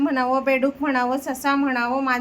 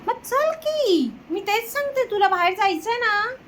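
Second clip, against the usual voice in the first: sing-song coaxing; 6 semitones higher than usual. Real, not acted. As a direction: stretch it out high to coax the dog. Exasperated sing-song to dog.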